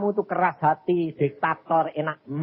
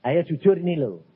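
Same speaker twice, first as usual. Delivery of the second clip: ordinary delivery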